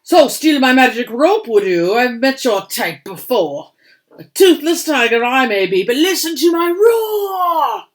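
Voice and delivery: loud furious accusation building to a roaring shout; an old tiger yelling at the suspected thief, ending in a roar; angry tiger Maharaja. Acted. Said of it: "The mystic Maharaja thought that Birdie was the thief".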